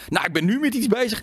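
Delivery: put-on high, childishly thrilled; a kid bursting to tell his news; childlike excitement. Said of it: "een beetje kinderlijk van".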